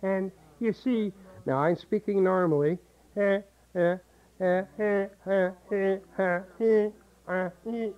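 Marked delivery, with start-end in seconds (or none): 3.2-7.9 pinched, back-of-throat eh; nasal eh. Shown as a demonstration. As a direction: squeeze the throat on each eh. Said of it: "I'm closing the back of my throat".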